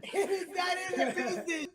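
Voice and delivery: high laughing protest. Real, not acted. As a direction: laugh through the words. Laughing hard.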